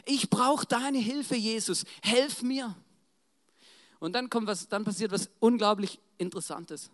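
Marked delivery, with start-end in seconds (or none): 0.0-2.8 raised, urgent plea, strongest at the start; crying out. Acted. Blind beggar begs Jesus for help, crying out. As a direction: call out for mercy, then plead.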